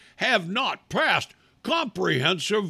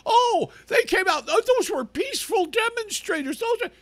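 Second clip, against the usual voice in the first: much higher, sneering mock pundit voice. Ranter mimics cable news hosts defending demonstrators, sneering falsetto. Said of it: "running their mouths about the McCloskey".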